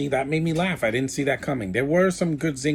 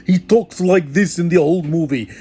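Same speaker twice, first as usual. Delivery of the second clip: loud, higher-pitched cartoon Russian accent; 5 semitones higher, 10 dB louder than usual. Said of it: "Jumba has a Russian accent".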